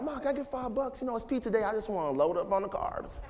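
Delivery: put-on high, wheedling kid voice asking for money; teen asking mom. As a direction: play his younger self begging mom for five bucks. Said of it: "asking my mom for".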